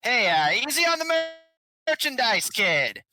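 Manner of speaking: high, amused villain king; sly, cackling king warning. Acted. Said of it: "and says".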